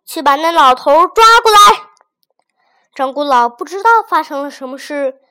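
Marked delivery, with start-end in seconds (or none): 0.0-1.6 loud, fast commanding order; an official snapping at his men, loud; barked order. A narrator's reading. Magistrate ordering his men to seize the old man, loud.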